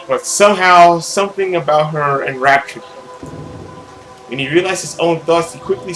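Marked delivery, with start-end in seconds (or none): none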